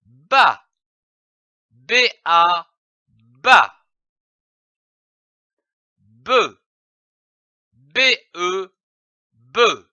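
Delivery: put-on teacher sounding out each letter for children; clear, deliberate syllable drill. Shown as a demonstration. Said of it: "Je lis une syllabe avec le son de la consonne B".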